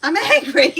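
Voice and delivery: loud, high mock anger; hammed-up angry squawk; imitated child's temper. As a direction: blurt it like an angry kid. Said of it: "one hell of a temper".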